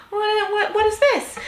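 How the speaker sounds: high, baffled what what what; squeaky stunned confusion; confused disbelief. Retold or imitated. Acting confused reaction.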